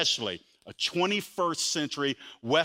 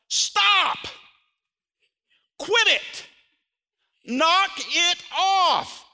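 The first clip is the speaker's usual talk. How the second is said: much higher and louder, smiling mock sternness; playful stern order; 12 semitones higher, 5 dB louder, much slower than usual. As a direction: bark it out playfully with a smile. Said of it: "He's smiling when he says it".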